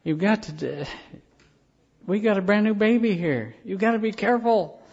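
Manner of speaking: put-on urgent wife warning about the baby; pleading wife. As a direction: plead with him like a worried young mother. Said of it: "And his wife said".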